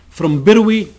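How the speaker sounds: a reader's contained yell; mild yell. A narrator's reading. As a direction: lift the pitch and call it out, restrained. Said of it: "he yelled".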